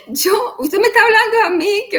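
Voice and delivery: furious retort to the oncologist; like a wild beast. Retold or imitated. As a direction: snap back at the doctor in outrage. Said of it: "yo me puse como una fiera".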